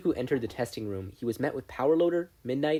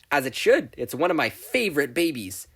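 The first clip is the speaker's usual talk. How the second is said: proud boast; 3 semitones higher, 6 dB louder than usual. A narrator's reading. Mei boasting loudly.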